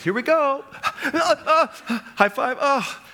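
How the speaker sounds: high, laughing singsong coach; upbeat swim teacher mid-lesson, high and laughing; cheery coach voice. Retold or imitated. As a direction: chirp it like a coach soothing a crying kid. Voicing cheerful swim coach with kid.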